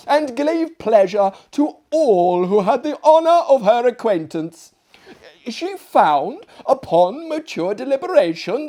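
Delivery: high, loud, eager madwoman's chatter; gushing lunatic guest. Acted. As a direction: babble proudly like a mad lady at dinner. Lunatic guest describes chicken lady.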